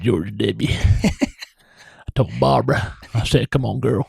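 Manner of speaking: a hint of a presidential impression; George W. Bush. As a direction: lightly do the former president telling a story. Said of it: "how you do it?".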